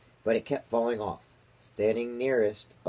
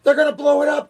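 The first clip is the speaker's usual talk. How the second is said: very high, loud alarmed cry; panicked cry; 11 dB louder, faster than usual. A narrator's reading. Army chief panics at the screen, very high and loud. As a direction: yelp it in alarm. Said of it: "cried the chief of the army".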